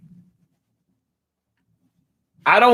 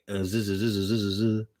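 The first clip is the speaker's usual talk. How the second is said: soft mock snore; 8 semitones lower, 8 dB quieter than usual. Mock snoring.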